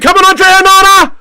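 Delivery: high, loud rallying shout; will the keeper to save it; urgent cheer. Real, not acted. Fan urging Andre Onana to save the penalty, loud cheer.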